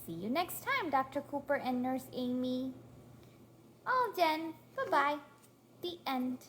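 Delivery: ordinary talk, nothing marked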